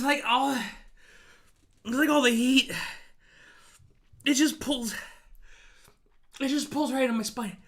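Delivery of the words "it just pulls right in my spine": higher, loud, pained from the chili burn; crying out through a burning mouth; mouth burning from spicy food. Real, not acted. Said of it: "It hurts on my lower gums".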